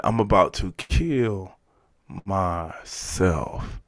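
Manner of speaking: slow, hushed, with words pulled apart; slow realization. Retold or imitated. Answering the inner voice the third time, slow realization. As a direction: draw out KILL, then sink low and soft on MYSELF. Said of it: "And then the third time".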